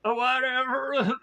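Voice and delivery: high, hammed-up teary outburst; mock emotional gush. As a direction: overdo being moved, jokingly. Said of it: "I'm like".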